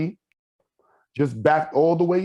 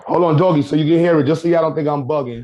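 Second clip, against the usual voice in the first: calling out; 3 semitones higher, 7 dB louder than usual. Real, not acted. Calls out to play clip.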